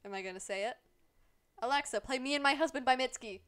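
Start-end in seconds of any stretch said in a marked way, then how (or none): 1.6-3.4 raised, crisp mock command to Alexa; calling out command. Streamer jokingly orders Alexa to play a song, calling out loudly. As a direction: call it out loudly to a voice assistant.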